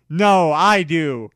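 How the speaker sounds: loud, slow shout; boy shouting at his rival. Acted. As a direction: bellow it back.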